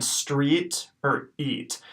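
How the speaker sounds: "street" and "eat" said slowly with a long ee; example ee sound. Shown as a demonstration. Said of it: "pronounced like the E sound in".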